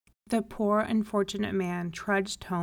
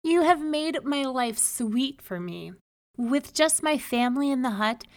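higher, mildly elated thanks; grateful cry; 5 semitones higher, 3 dB louder than usual. A narrator's reading. Man thanking the rabbi for his life, gratefully delighted. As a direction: pour out thanks with a lifted voice. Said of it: "he cried".

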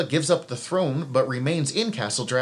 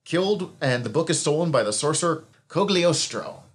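ordinary delivery

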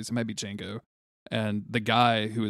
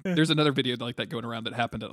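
ordinary delivery

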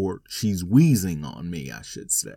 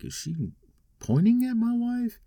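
high, incredulous inner question; surprised disbelief. Retold or imitated. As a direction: gasp it out as if you can't believe your eyes. Inner disbelief, spotting the pointing.

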